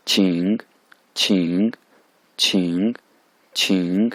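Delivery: छिंग dipping then rising; third tone demo. Shown as a demonstration. Teacher drilling छिंग in the third tone. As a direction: let छिंग dip and rise. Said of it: "third tone है उस पर".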